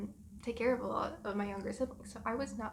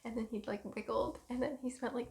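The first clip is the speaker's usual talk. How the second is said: usual speaking voice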